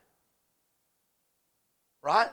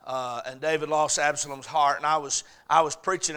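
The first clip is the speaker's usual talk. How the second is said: ordinary talk, nothing marked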